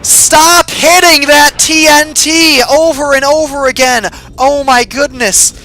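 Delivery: loud, high, drawn-out yelling; pissed-off shouting. Real, not acted. Gamer hitting the TNT repeatedly, shouting pissed off. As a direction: yell till your ears ring. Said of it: "I'm so fucking pissed off".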